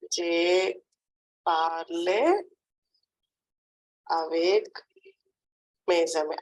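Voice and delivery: Je, parle, avec, mes amis with long pauses between; word-by-word dictation. Shown as a demonstration. Teacher dictates a French sentence to a pupil, word by word. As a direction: break it into separate chunks for a learner. Teacher repeats it slowly in parts.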